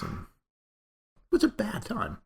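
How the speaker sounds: hushed whisper, slow. Real, not acted. Remembering the falling-out with Steve, whispered. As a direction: breathe it out as a whisper. Recalling a bitter period.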